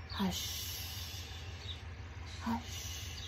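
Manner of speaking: long, whispered HUSH; very calm. Shown as a demonstration. Teacher hushing as if to a baby or kitty, very calmly. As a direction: breathe "Hush" out softly, stretching the sh. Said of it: "We're saying it very calmly".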